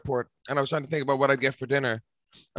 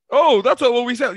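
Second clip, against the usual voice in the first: mock worker voice; 7 semitones higher, 5 dB louder than usual. Mocking a McDonald's worker's reply.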